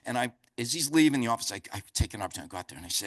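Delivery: usual speaking voice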